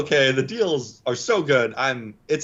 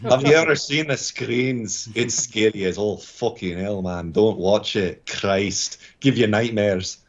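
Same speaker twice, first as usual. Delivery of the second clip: put-on Scottish brogue; Scottish accent; 3 semitones lower, slower than usual. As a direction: grumble a warning in a Scottish accent. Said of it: "love the Scottish accent".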